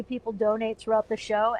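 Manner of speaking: normal talking voice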